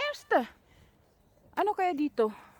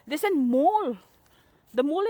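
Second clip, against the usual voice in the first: usual speaking voice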